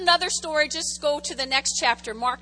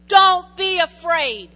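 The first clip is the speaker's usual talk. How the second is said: loud, slow, firm order; direct command; 4 semitones higher, 12 dB louder, slower than usual. Acted. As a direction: command him, no softness. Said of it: "gives that guy a direct command".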